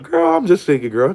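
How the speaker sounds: high, sing-song sassy reply; playful sassy reply. Retold or imitated. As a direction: toss it off lightly, high and teasing. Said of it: "And I'm like".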